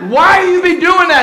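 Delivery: loud, high incredulous shout; imitating a bewildered churchgoer; mock shout. Imitating someone asking Pop why he runs, loud shout. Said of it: "somebody said".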